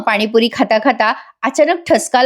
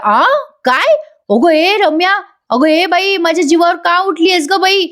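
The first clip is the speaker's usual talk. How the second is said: high, alarmed mock panic; a mother half-joking in fright; 7 semitones higher than usual. Mother reacts to her daughter threatening to poison her, in mock alarm.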